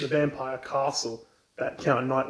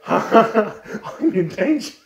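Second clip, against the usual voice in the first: nervous laugh; 6 semitones higher, 3 dB louder than usual. Acted. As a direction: laugh through the panic. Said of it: "Kara's just like".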